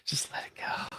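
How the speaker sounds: breathy whispered echo. Acted. Host echoing the co-hosts' advice, whispered. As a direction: whisper it like a secret.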